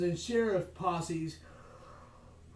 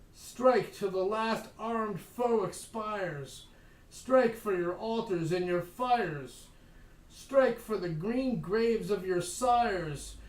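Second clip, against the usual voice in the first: rousing recitation; 2 semitones higher, slower than usual. Acted. Handbill verse recited.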